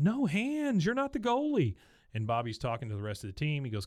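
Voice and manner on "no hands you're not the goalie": very high fussy coach voice. Retold or imitated. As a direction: hold the kid back in a high anxious call. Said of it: "The coach says to him".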